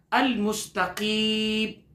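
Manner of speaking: very slow, drawn-out tajweed chanting; slow chanted recitation; slow Quran recitation. Shown as a demonstration. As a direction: stretch the word for students to echo. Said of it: "میرے ساتھ ساتھ پڑھیں گے".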